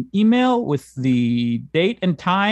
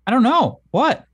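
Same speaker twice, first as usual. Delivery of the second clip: 1 playful mock bewilderment; 10 semitones higher, 4 dB louder than usual. Shown as a demonstration. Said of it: "let's try again".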